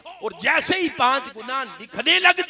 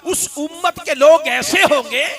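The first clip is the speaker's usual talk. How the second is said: higher, louder building sermon cry; fervent preaching; 6 semitones higher, 6 dB louder than usual. Acted. Preacher recounting Moses's plea, fervent. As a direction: lift the crowd with each phrase.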